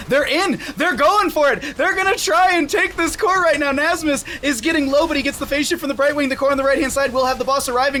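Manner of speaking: rising, shouted hype call; excited play-by-play. Real, not acted. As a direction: build the excitement as they push. Caster hyping the core race.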